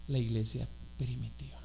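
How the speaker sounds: ordinary talk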